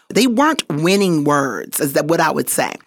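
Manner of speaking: normal talking voice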